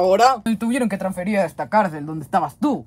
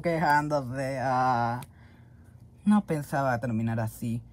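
low, soft, dejected muttering; downcast, low and soft; glum resignation; 7 semitones lower, 7 dB quieter, slower than usual. Acted. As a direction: sink into quiet self-pity. Glum prisoner resigned to his fate.

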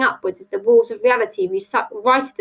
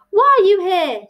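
high, loud question; alarmed demand; 10 semitones higher, 6 dB louder than usual. Acted. Tilly confronts Mister Chalk, alarmed demand. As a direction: call it out, alarmed.

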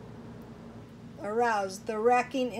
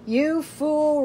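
higher, louder, thundering scorn; ringing rebuke; 5 semitones higher, 4 dB louder than usual. A narrator's reading. Deimos rebukes Bendigaz for doubting the lord, thundering rebuke. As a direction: let it ring out terribly. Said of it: "rang out the voice of Deimos".

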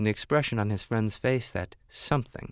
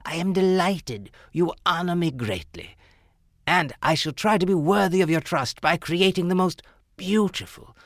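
formally addressing royalty; 8 semitones higher, 4 dB louder than usual. Acted.

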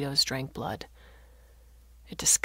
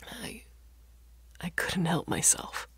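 soft, halting, on the edge of a sob; softer, breaking voice; 6 dB quieter than usual. A narrator's reading. Ashamed father to his daughter, softly breaking with a sob. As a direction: let the voice break quietly after a pause. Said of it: "His voice, softer now, broke with a sob".

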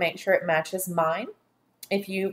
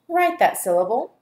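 normal talking voice

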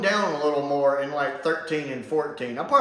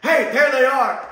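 higher, louder call of triumph; guards calling out; 9 semitones higher, 8 dB louder than usual. Retold or imitated. Guards spot the Doctor and Sarah, loud triumphant call. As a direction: shout it like a guard spotting prey. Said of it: "guards ... are like".

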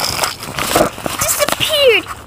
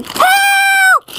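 shouted call for help; 8 semitones higher than usual. Acted. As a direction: yell it out for rescue. Trapped character calls for help.

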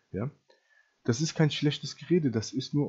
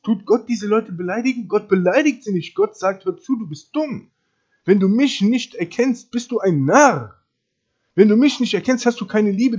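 loud, high-pitched preacher's rebuke; raised voice, pounding out each accusation; forceful preaching; 7 semitones higher, 7 dB louder than usual. Real, not acted. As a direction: thunder God's verdict at the listeners. Preaches God's rebuke at full force.

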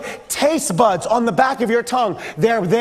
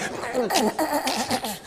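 hushed, sputtering held-in laugh; stifled laugh; 4 semitones higher, 8 dB quieter than usual. Shown as a demonstration. Preacher demonstrates laughing in church, muffled suppressed snickers. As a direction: choke back a laugh through closed lips. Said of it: "you're just holding this in".

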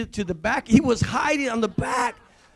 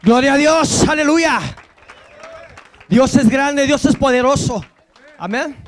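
high, loud pulpit praise; loud Spanish praise; 4 semitones higher, 10 dB louder than usual. Acted. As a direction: proclaim God's greatness to the crowd.

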